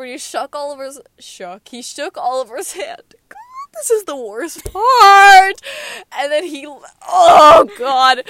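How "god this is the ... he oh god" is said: high, loud, drawn-out wail of dismay; loud exasperated groaning cry; loud dread. Real, not acted. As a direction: wail as the worst part comes. Dreading the worst part of the story.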